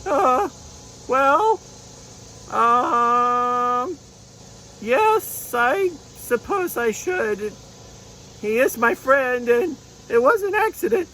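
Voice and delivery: high, slow, hesitant unicorn; little unicorn giving in slowly; reluctant unicorn puppet. Pretend. Unicorn puppet agreeing to forgive, high and hesitant.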